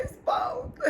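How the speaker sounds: high, teary voice breaking; real tears, voice cracking high; crying. Real, not acted. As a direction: choke the words out through tears. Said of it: "I started breaking down crying".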